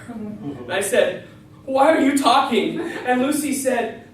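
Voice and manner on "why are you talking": loud startled question; shocked. Retold or imitated. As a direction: blurt it out in shock. Shocked at talking dog.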